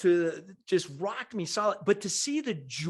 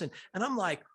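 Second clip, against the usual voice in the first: ordinary talk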